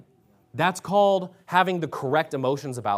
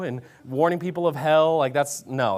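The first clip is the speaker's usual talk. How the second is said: ordinary delivery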